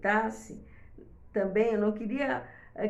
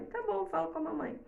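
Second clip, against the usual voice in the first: imitating a child; 7 semitones higher, 4 dB quieter, faster than usual. Child agrees with mother.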